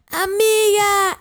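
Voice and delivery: put-on high, slow, shrieked party-girl call; party girl shout. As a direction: shriek it like a spoiled girl at a club. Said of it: "voz de niña fresa ... que grita".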